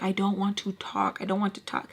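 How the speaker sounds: soft, subdued retold protest; quiet refusal. Woman to Sugar after the fight, quiet refusal. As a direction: say it softly, shutting the conversation down. Retelling her refusal to talk.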